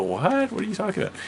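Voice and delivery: put-on high-pitched retort of a woken kid; bewildered protest. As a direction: ask it high and incredulous. Woken child questions his sister.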